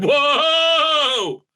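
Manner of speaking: loud, high stretched whoa; mock Alex Jones whoa. Imitating Alex Jones reacting on his show, loud mock whoa. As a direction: blurt it like a stunned host. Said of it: "Alex Jones was like".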